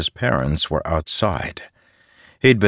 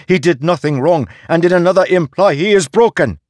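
high, loud, fast bitter echo of his words; mother quoting the farmer back in anger; mocking repeat; 13 semitones higher, 8 dB louder, faster than usual. A narrator's reading. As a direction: throw his own phrase back at him. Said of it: "Mother said".

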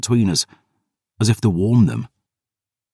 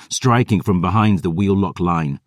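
ordinary delivery

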